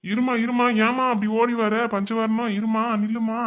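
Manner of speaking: high, loud call to stop; urgent calling out. Acted. Brother calls running Panchavarnam to wait, urgent.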